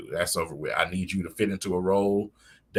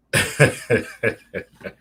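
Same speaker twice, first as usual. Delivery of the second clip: laugh; 4 semitones higher, 5 dB louder than usual. Real, not acted. Laughing at own joke.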